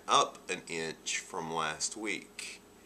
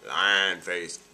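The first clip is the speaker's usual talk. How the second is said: loud, drawn-out stretched-face voice; straining lion face; 7 dB louder than usual. Shown as a demonstration. Showing viewers a puffy-eye exercise, loud strained lion face. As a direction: say it slowly through a wide, stretched face.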